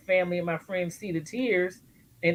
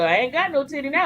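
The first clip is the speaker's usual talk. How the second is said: lightly voiced aunt, higher and quick; imitated aunt; 7 semitones higher, faster than usual. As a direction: playfully quote a protesting aunt, pitched up and fast. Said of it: "my aunt was like".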